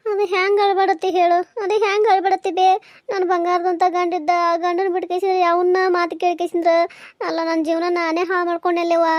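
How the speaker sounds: loud, distressed wailing plea; acted anguished lament. Daughter mourning her broken marriage to mother, anguished. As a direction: lament loudly as if in tears. Daughter weeping over lost husband.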